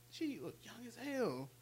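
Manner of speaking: ordinary talk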